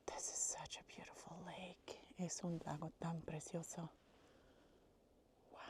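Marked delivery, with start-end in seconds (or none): 0.1-3.9 soft hiking whisper. Real, not acted. To viewers on a quiet lake trail, a soft whisper. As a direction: breathe the words quietly by the lake.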